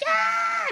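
high squeal; a toddler's delighted shriek up high. Retold or imitated. Dad imitating his son running off with a high squeal. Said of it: "He's like".

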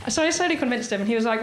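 ordinary talk, nothing marked

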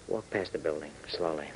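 hushed order. Acted. Hop tailing the suspect, hushed quiet order. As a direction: keep it down so she can't hear. Sneaking past the suspect's building.

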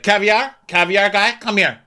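high, loud imitation of Alexia calling out; calling out like a reality-show diva; mock Alexia. Friend mimics Alexia summoning the caviar guy, high and loud.

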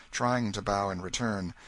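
usual speaking voice

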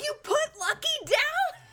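high, slow outraged question; indignant. Retold or imitated. Kids confronting mom about the dog, high slow outrage. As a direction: confront her in disbelief.

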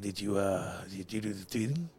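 low, soft mock wife asking "did you do the tweeting"; a hushed impression of a nagging wife. Husband imitates his wife's voice message, low and hushed.

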